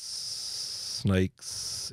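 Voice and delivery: snakes with a long, whispered hiss on the S; hissed S demo. Shown as a demonstration. Showing viewers the de-esser at work, hissing the S. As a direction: lean into the S of snakes to show off sibilance. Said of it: "when I say the letter S".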